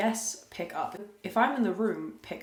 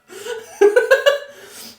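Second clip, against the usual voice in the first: loud high laugh; 13 dB louder than usual. Real, not acted. Laughing at boyfriend's unbothered reply, loud and high. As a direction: burst out in a high cackle.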